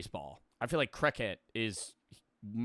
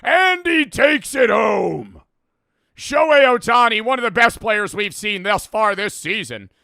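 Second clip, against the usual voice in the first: mock sports announcer; 10 semitones higher, 14 dB louder than usual. Imitating a commentator calling Ohtani's big play. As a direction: boom it out like a baseball announcer. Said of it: "They, like, deepen their voice".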